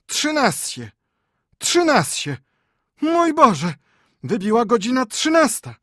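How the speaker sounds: very high, loud frantic cries; old men panicking over the thirteenth stroke; panicked old men. Acted. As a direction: shriek it in horror.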